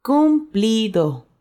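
normal talking voice

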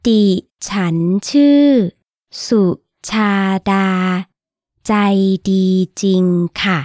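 broken into slow chunks; slow, with pauses between chunks; slowly, in chunks. Shown as a demonstration. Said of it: "Again, slowly".

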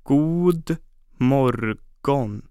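"God morgon" very slow, each syllable separated and drawn out; broken into syllables. Shown as a demonstration. Teacher breaking "God morgon" down for listeners, very slow and syllable by syllable. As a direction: spell out "God morgon" slowly, syllable by syllable for learners. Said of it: "Let's break it down by syllable".

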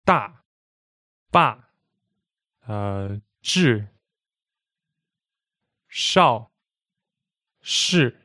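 DA, BA, ZHI, SHAO, SHI each falling sharply; a little mad, emphatic drop on "da", "ba", "zhi", "shao", "shi"; isolated falling "da, ba, zhi, shao, shi"; fourth tone. Shown as a demonstration. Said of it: "Sound a little bit mad".

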